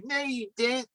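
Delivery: put-on high-pitched local Pennsylvania accent; "No, you didn't" accent. Guest demonstrates Bam's local accent, high mimicry. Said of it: "where they go".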